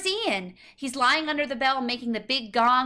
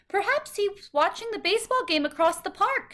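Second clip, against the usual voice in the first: a lady's higher, helpful suggestion; suggesting lady; 9 semitones higher than usual. A narrator's reading. A stranger in the park suggesting where the boy went, helpful. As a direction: offer a gentle guess as a stranger. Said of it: "she suggests".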